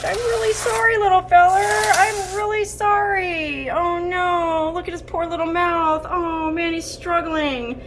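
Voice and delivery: high, pained pleading, nearly in tears; sorry to the snake. Real, not acted. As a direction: coo sorrowfully at the suffering little snake.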